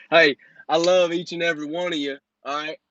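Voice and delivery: normal talking voice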